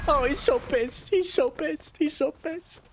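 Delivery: high slow whimpering repetition; high panicked moan. Real, not acted. Gamer hiding from furious boss, high panicked repeating. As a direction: whimper it over and over in fear.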